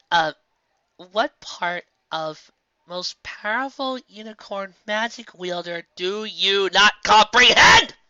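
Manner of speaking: taunting with crazed pride; unhinged mockery. A narrator's reading. Filly taunts Nightmare Moon, slow building sneer. Twilight mocks, unstable.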